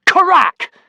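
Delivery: shouted sound word, high and loud; loud crack. A narrator's reading. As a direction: burst it out like a sudden crack. Sharks crack barrel, narration climax.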